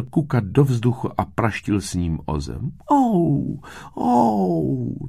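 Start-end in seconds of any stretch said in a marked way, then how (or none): 2.9-5.1 high, drawn-out wail of pain; moaning. A narrator's reading. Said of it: "naříkal".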